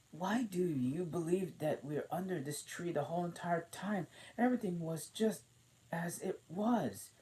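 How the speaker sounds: put-on high, lightly puzzled girl voice; a curious little girl wondering aloud; Alice voice. A narrator's reading. Alice's lines.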